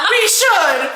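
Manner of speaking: laughing through the words, high. Real, not acted. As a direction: crack up as you say it. Laughing at absurd recovery.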